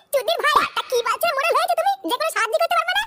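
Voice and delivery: high fast furious mockery; angry rant. Club member rages at the useless headman. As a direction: sneer and rage at him.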